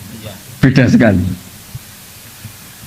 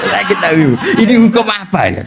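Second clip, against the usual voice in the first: acted protest to God, pitched higher; complaining prayer; 7 semitones higher than usual. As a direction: plead upward like an aggrieved wife. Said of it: "Pulang di kamar dia sujud".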